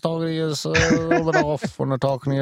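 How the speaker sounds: put-on high, odd German-ish local accent; weird accent. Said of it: "I kinda talk a little weird like this".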